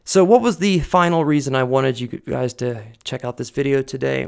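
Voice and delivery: ordinary delivery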